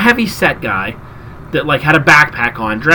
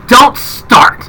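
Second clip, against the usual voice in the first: irritated snap; 7 semitones higher, 4 dB louder than usual. Retold or imitated. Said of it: "he instantly got pissed".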